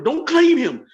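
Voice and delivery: high, loud snap; sudden outburst. Real, not acted. Scolding the in-laws in a sudden high outburst.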